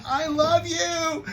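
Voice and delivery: high, stretched-out call at moderate volume; mock yell over a car horn.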